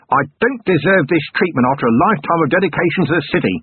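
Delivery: a touch of indignant exploding; understated burst of wounded pride; mild outburst. A narrator's reading. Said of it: "Vimes thumped his desk and exploded".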